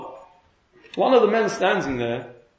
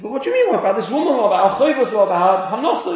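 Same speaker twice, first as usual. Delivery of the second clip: higher, insistent string of challenges; acted protest; 4 semitones higher, 5 dB louder than usual. Protesting to the finder about the woman, mock indignant. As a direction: object heatedly, imitating an indignant challenger.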